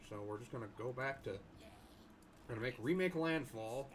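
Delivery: ordinary talk, nothing marked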